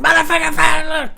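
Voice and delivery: hammed-up brawl yell; crazy screaming. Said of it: "Crazy screaming".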